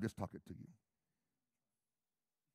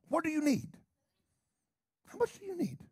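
pressing question. Acted.

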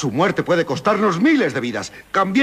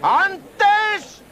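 carnival barker; 13 semitones higher, much slower than usual. Acted. Pitchman calling the crowd.

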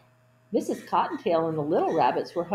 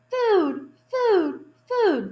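high, loud bird calls; birds calling food; 12 semitones higher, 6 dB louder, much slower than usual. Acted. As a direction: cry it out like hungry birds. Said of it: "they called".

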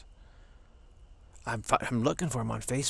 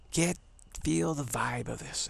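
ordinary delivery

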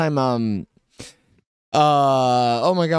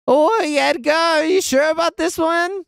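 high, put-on voice questioning a writer; mock a sceptical reader, pitched well up; hammed-up high-pitched doubt; doubtful script reader; 9 semitones higher than usual. Imagined script reader doubting Edgar's writing.

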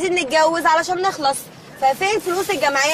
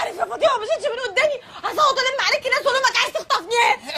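laughing threat; 8 semitones higher than usual. Acted. Threatening to scream for help.

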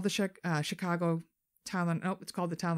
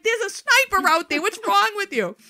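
much higher and louder, exasperated; exasperated shout; 11 dB louder than usual. Retold or imitated. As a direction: yell it at her in disbelief. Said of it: "He's like".

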